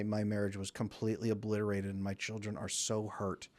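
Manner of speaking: soft, low, quietly grieving; mild heartbroken testimony. Retold or imitated. Hurt mothers telling of broken families, soft and grieving. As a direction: speak the hurt gently and low. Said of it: "women who come in and say".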